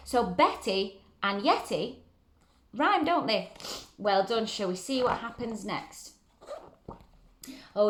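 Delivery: ordinary delivery, nothing marked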